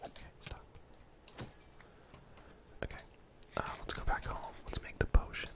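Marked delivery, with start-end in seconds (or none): none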